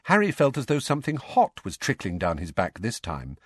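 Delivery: ordinary delivery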